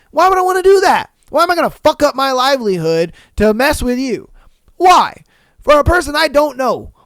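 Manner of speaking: loud, high-pitched indignant rant; exasperated retort. Retold or imitated. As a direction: fire back at an accusing customer in disbelief. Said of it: "and I'm like".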